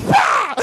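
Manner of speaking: a high, loud "Wah!" as the sled leaps; mock yell. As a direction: whoop it out like you're flying off the ground. Imitating the sled lifting off.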